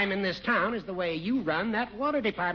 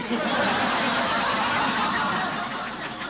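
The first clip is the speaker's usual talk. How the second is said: mocking laugh; 6 semitones higher, 4 dB louder than usual. Judge mocking Gildy.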